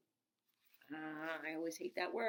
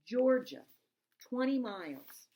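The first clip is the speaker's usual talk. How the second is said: reading the sign; 6 semitones higher, 9 dB louder, slower than usual. Acted. Reading road sign aloud.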